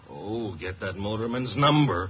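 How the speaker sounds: usual speaking voice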